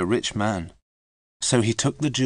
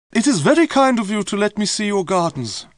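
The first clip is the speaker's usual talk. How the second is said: high, louder gracious visitor's voice; Lady Wardrop; 11 semitones higher, 5 dB louder than usual. A narrator's reading. Visiting lady thanks host for seeing gardens, high gracious voice. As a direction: greet the host with lofty politeness. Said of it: "Lady Wardrop said".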